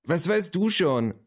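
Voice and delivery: slow, high-pitched scornful retort; hissed retort. A narrator's reading. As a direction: throw it back crossly, drawn out and high. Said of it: "zischte Luisa". (gezischt, hoch und gedehnt)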